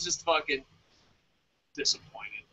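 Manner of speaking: hushed letdown; whispered. Real, not acted. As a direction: whisper the word disappointed. Trails off disappointed.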